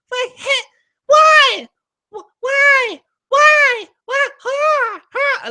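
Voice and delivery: squeaky, very high repeated squeals; high-pitched self-mockery. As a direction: mimic your own voice shooting up high. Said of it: "so high-pitched there".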